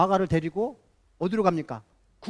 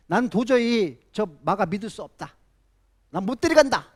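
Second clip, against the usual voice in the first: Paul refusing Mark, raised voice; mock quarrel, louder and higher; imitating angry shouting; 4 semitones higher, 6 dB louder than usual. Paul arguing with Barnabas about Mark, loud retold shouting. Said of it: "큰 소리로 막 싸우면서".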